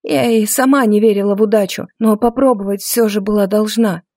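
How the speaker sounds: ordinary delivery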